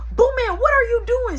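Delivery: high, loud mom calling out Boo man. Retold or imitated. Mom calling her son from the room, high and loud. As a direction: call up the stairs like a mom. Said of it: "So my mom say".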